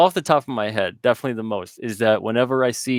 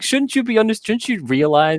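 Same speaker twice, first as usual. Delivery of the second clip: high-pitched, put-on reproach; mock indignation; 12 semitones higher than usual. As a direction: plead with the dog's owner in mock disbelief. Mock reproach to annoyed dog owners.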